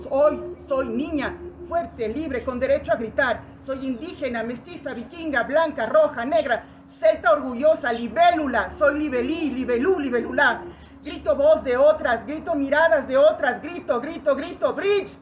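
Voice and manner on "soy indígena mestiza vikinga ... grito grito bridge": building poetic cry; proud outcry. Acted. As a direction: proclaim it with raised, insistent voice. Proud self-naming, repeated "I shout.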